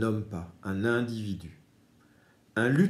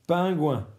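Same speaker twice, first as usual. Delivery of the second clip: pingouin dit haut et fort, bien détaché; mot appuyé; 8 semitones higher, 6 dB louder than usual. Shown as a demonstration. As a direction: annonce pingouin clairement aux enfants. Mot-image dit fort à l'enfant. (pingouin articulé haut et fort pour la classe)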